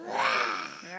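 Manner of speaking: low mock lion growl; lion roar. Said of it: "are you a lion?".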